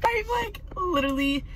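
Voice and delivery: stunned, voice jumping up; breathless disbelief; shocked. Real, not acted. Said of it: "literally shocked right now".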